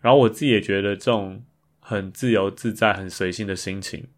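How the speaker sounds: ordinary talk, nothing marked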